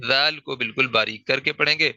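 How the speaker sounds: ordinary talk, nothing marked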